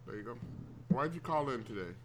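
ordinary delivery

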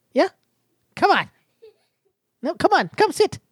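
dad coaxing his shy daughter onto the mic, high and encouraging. Real, not acted. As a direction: lift the voice way up and coax her over gently. Coaxing his six-year-old to join.

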